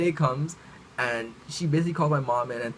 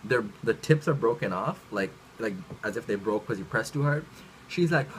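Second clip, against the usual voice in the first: ordinary talk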